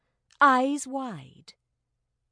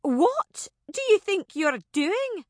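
indignant raised challenge; angry; 10 semitones higher, 5 dB louder than usual. Acted. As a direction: snap at him in outrage. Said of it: "She was angry".

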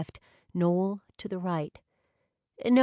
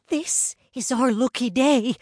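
put-on high, loud, thrilled young friend; excited Brianna; 7 semitones higher, 7 dB louder than usual. A narrator's reading. Brianna gushing to her friends about spotting the twins, excited.

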